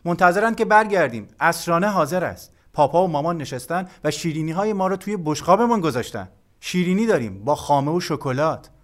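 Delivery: high, loud, eager call; loud announcement. A narrator's reading. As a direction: call the good news up to your brother. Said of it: "با صدای بلند گفتم".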